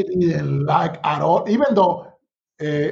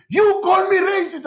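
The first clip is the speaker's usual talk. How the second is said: indignant accusation; 13 semitones higher than usual. Retold or imitated. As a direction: throw the accusation back like an offended colleague. Colleague angrily confronts him.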